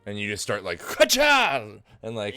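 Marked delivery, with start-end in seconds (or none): 0.8-1.7 high, loud riding cry; urging a mount. Acted. Game master plays rider starting the beetle galloping, urging cry. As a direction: yell it out high, spurring an animal on.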